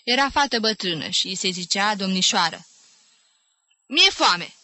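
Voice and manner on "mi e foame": short, higher-pitched announcement; brusque and raised; curt declaration. A narrator's reading. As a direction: state it bluntly as a demand. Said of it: "declară scurt Jim".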